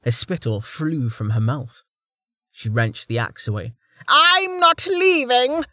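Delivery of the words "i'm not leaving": very high, slow shriek of rage; enraged refusal. Acted. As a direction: shriek it as a furious old woman, drawn out. Enraged old woman refuses to leave.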